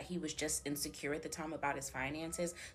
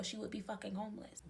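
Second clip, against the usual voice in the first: hushed whisper for the shocking reveal; whispered aside to the listener; dramatic whisper; 4 semitones higher, 6 dB quieter than usual. Real, not acted. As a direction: drop to a whisper on the punchline.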